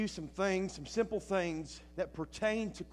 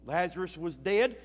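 ordinary delivery

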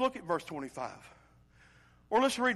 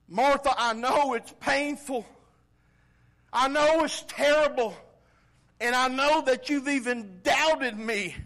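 impassioned preaching; 9 semitones higher, 12 dB louder, slower than usual. Acted. Voicing Jesus to Martha.

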